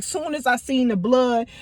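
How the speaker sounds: ordinary delivery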